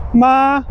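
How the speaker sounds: "Ma" high and level; first tone ma. Shown as a demonstration. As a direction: hold "Ma" flat at the top. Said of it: "we have first and second".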